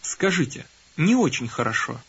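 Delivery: usual speaking voice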